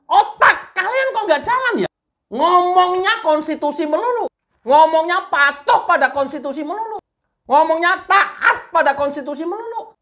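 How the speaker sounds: high, exasperated tirade; angry scolding. Real, not acted. Commentator scolding governors and mayors who preach the constitution, angry exasperation. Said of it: "jangan marah-marah".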